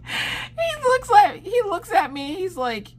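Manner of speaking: very high, squeaky through giggles; real laughing squeal; stifled laughter. Real, not acted. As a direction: struggle to talk while cracking up. Cracking up while telling the story.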